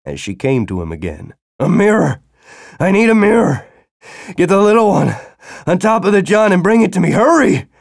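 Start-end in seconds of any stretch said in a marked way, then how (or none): 1.6-7.7 higher, louder frantic orders; urgent shouting. Acted. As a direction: demand it in a frenzy. Feverish man orders child.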